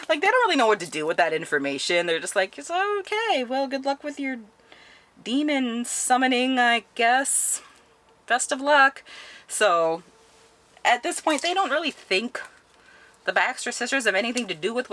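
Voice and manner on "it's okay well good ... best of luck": high-pitched, sceptical well-wishing; awkward humouring. Retold or imitated. Friends answer the demon-summoning sisters, high awkward humouring. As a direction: humour them in a much higher voice.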